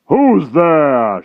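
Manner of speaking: loud, high startled giant shout; shriek. A narrator's reading. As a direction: bellow it into the dark. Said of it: "shrieked Gallopus".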